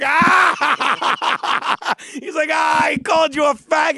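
loud, high laughing shout. Retold or imitated. Stranger in the elevator bursting out laughing and shouting. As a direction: crack up and yell it out.

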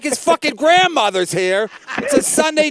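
exasperated reproach, pitched high; retold yelling. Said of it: "I was yelling at him".

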